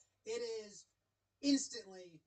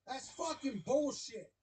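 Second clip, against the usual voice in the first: higher mock angry back-and-forth; voiced manager-umpire argument; 6 semitones higher than usual. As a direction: act out the dugout quarrel. Voicing argument between manager and umpire.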